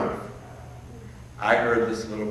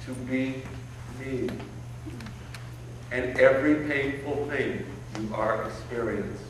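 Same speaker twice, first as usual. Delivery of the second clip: softer, tender promise with a smile; smiling reassurance; 8 dB quieter than usual. Retold or imitated. Grown man promising his child self a good future, softly smiling. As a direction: soothe the little boy, hushed and sure. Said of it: "And I smiled and said".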